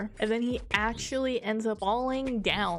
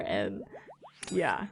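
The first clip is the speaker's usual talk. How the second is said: normal talking voice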